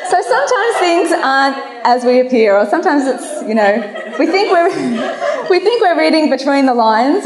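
amused, laughter breaking into the words; laughing. Real, not acted. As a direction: try to carry on while giggling. Laughing after her own joke.

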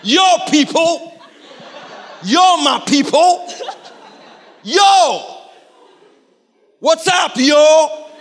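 high, loud hype call to the crowd; exclaiming out loud. Retold or imitated. Preacher shows Jesus exclaiming to the crowd, loud hype call. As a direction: shout it out like calling your people over. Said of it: "It's like going".

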